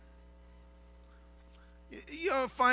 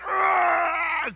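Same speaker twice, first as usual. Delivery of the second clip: frustrated cry; 12 semitones higher, 8 dB louder than usual. Retold or imitated. Frustrated outcry at loved one.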